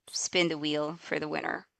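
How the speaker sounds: normal talking voice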